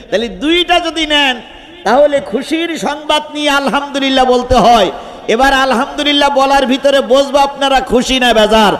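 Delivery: high, loud call for a crowd response; rallying preaching. Real, not acted. Preacher asking the crowd to praise God together, high rallying call. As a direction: build it up and urge them all to answer.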